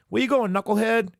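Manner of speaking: shrill-free high scold, lightly hammed; imitating Chi-Chi. Host imitating Chi-Chi calling after Goku, high mock scold. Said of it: "she's like".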